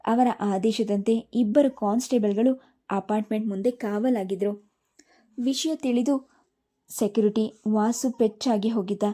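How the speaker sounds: usual speaking voice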